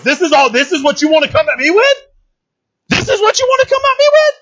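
high-pitched incredulous challenge, climbing on the repeat; calling him out. Retold or imitated. God challenges the boastful enemy, calling him out. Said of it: "he's calling him out".

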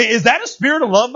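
usual speaking voice

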